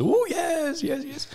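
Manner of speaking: high, laughing mock of an eager shopper; eager woman. Voicing the woman keen for a free sausage, high and laughing. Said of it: "And the woman's".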